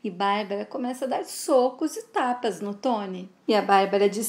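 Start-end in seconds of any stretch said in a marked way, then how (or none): none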